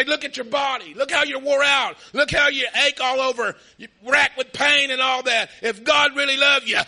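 loud accusing devil. Retold or imitated. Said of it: "He'd say".

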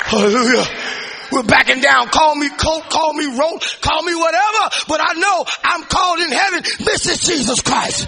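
loud, high preaching with a shouted build; full-force preaching. Real, not acted. Preacher urging the church not to back down, shouting. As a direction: drive the congregation, don't let them back down.

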